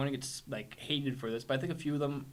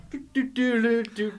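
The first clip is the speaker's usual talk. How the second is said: juggling hum; 9 semitones higher, 5 dB louder than usual. Retold or imitated. Mimicking juggling.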